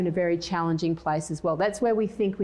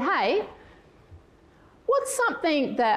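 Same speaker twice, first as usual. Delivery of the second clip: higher-pitched, coaxing retold question; coaxing request; 8 semitones higher than usual. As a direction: put it to him in a raised, cajoling pitch. Retelling her request to husband.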